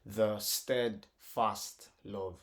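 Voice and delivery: slow "The steadfast love", each syllable apart; broken into syllables. Shown as a demonstration. Teacher repeats the breakdown, "The steadfast love" slowly syllable by syllable. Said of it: "break that into syllables".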